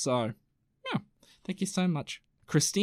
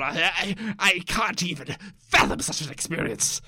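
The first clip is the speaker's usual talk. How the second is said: appalled well-to-do Victorian; 5 semitones higher than usual. Acted. Well-to-do person hit by chimpanzee poo, hammed-up outraged disgust. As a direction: splutter like a scandalised well-to-do Londoner.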